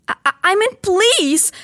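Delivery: flustered stammer. Acted. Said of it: "said Harry quickly".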